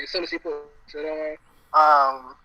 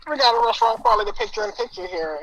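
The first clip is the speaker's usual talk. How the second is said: muffled impression; 6 semitones higher, 3 dB louder than usual. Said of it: "that's how he sounds".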